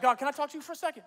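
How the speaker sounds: quick, high-pitched comic worry; nervous aside. Retold or imitated. Gideon turning to God after the rally, nervous. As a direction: hurry it out, suddenly unsure.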